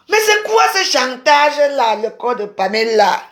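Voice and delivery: high, loud indignant complaint. Real, not acted. Streamer protesting to viewers about Pamela, loud and indignant. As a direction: protest loudly at the blackmail.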